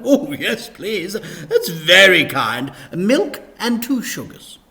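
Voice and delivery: high, loud, over-eager vicar voice; high loud cheery vicar; gushing vicar. Acted. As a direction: beam it out like a toothy vicar.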